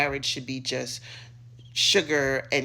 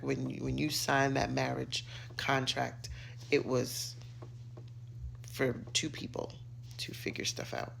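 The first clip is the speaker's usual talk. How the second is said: hushed aside; 7 dB quieter than usual. Real, not acted. Pointed aside on marriage contract.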